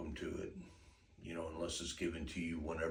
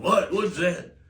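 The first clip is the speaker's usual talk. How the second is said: much higher, louder spooked cry; startled dog voice; 14 semitones higher, 15 dB louder, slower than usual. Pretend. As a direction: voice the spooked dog, high and loud. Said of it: "dog ain't going".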